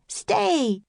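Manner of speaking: high firm call; shouted command. A narrator's reading. Annie shouting at the running puppy. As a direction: order the dog to stay. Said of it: "shouted Annie".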